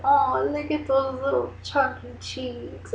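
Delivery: put-on slow, cooing baby-talk. Teasing the fiance about his baby photos, cooing. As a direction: coo at chubby cheeks. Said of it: "I used to be like".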